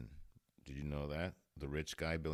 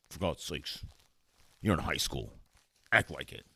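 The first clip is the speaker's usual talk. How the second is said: scolding fifties dad, building louder; mock stern father; 6 dB louder than usual. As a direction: lay down the law as Dad, ending loud. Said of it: "I am the father here".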